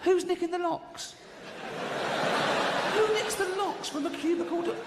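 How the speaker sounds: high-pitched, incredulous rant; hammed-up indignant disbelief; comic outrage. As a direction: demand it in high exasperation. Comic outrage at missing locks.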